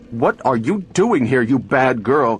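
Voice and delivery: a touch of an anxious mother's scold; mild scolding cry. A narrator's reading. Mother finding Gloria, scolding her lightly. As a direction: call out sharply to the child. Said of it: "cried Missus Weston".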